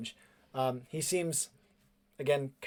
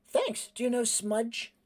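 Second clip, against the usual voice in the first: put-on high-pitched boy's voice; boy character; 9 semitones higher than usual. Cleven showing his lunchbox, high boyish voice. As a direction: ask it high and hopeful.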